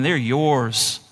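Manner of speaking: ordinary talk, nothing marked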